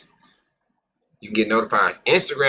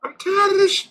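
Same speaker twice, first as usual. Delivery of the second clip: exasperated rant; 6 dB louder than usual. Retold or imitated. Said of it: "it's like".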